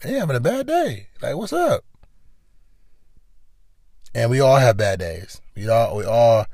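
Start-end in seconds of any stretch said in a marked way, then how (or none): 0.0-1.8 mocking clueless person. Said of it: "People like".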